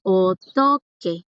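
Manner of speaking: slow, careful "Otoque", each syllable drawn out; teacher models "Otoque" slowly for students; slow Korean word demo. Shown as a demonstration. Teacher drilling students, slow syllable-by-syllable model. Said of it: "Eso lo han escuchado".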